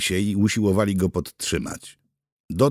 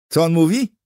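high, loud demanding question; insistent asking; 10 semitones higher, 7 dB louder than usual. A narrator's reading. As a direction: press loudly for the translation. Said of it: "dopytywał się Wasiak". (natarczywe, głośne dopytywanie)

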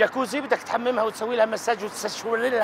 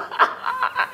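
mock breathy giggle. Mocking her cackling again.

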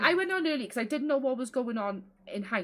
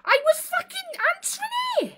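high, loud indignant yell; angry shout; 8 dB louder than usual. Real, not acted. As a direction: shout back in real outrage. Angry gaming argument on call.

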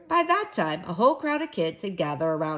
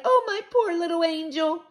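high-pitched pitying fuss; crying out; 10 semitones higher, 3 dB louder than usual. A narrator's reading. Mother to her injured toddler, wailing with pity. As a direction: coo with distress over a hurt child. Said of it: "my mother cried".

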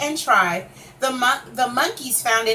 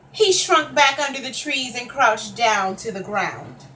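ordinary delivery, nothing marked

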